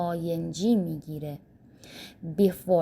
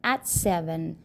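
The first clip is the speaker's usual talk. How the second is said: slow, clear English model; slow model sentence; much slower than usual. Shown as a demonstration. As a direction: lay it out word by word for learners. (آهسته و شمرده)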